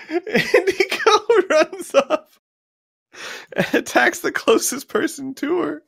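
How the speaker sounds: helpless high-pitched laugh through the words; cracking up mid-narration; laughing while talking. Real, not acted. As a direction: barely get the sentence out for laughing. Cracks up narrating bad roll.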